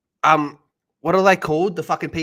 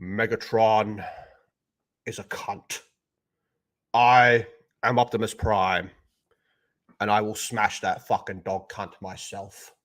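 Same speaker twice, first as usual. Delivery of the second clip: Peter Cullen Optimus; 3 semitones lower than usual. Retold or imitated. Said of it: "We need Peter Cullen to do the voice".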